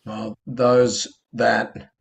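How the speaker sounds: ordinary talk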